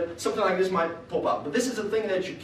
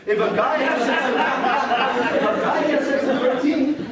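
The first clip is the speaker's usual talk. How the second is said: slow, louder, drawn-out setup; slow emphatic setup; 6 semitones higher, 5 dB louder, slower than usual. Acted. Comic telling the audience about thirteen-year-olds, slow emphasis. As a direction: lean on every word to build the punchline. Building a punchline.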